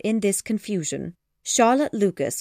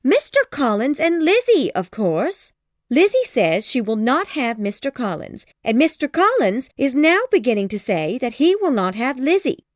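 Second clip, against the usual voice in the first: higher, louder frantic wife; agitated plea; 6 semitones higher, 4 dB louder than usual. Acted. Wife urging husband, high frantic plea. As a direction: beg your husband in a flurry. Mrs Bennet voiced.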